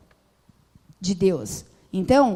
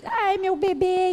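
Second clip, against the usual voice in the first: put-on high, slow cooing over a baby; doting mother; 7 semitones higher, slower than usual. Mocking a mother who dotes on her baby, high slow coo.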